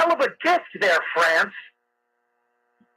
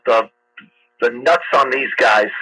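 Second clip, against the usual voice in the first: usual speaking voice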